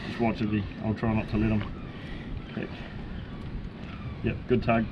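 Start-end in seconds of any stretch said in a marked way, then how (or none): none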